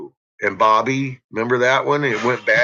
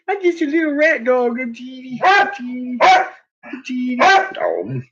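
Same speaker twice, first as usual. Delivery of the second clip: put-on much higher cutesy voice, slowing on teeny; baby talk; 13 semitones higher than usual. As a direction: coo at the tiny dog. Baby-talking about the small dog.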